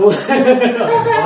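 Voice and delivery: high loud burst of laughter; embarrassed hearty laugh. Real, not acted. Laughs at the memory.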